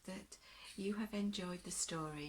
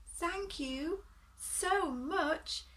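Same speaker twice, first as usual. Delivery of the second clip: high, loud and slow, full of thanks; a freed creature overflowing with thanks; grateful beast; 7 semitones higher, 6 dB louder, slower than usual. Acted. Healed beast thanking Aluna, high and drawn out.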